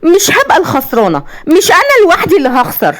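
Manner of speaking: high, deranged vengeful threat; unhinged villainess, pitch climbing with spite; gloating. A narrator's reading. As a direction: build from gloating to a crazed vow. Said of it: "وابتسمت بشماتة وقالت".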